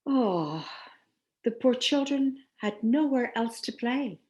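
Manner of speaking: usual speaking voice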